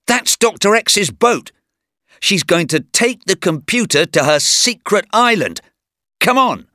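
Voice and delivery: high-pitched, urgent alert; urgent excitement. A narrator's reading. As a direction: urge your companion on, pitched high. Urgent discovery, urging companion on.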